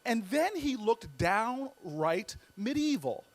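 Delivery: normal talking voice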